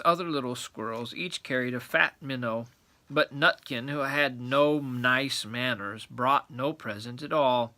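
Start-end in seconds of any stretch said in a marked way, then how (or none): none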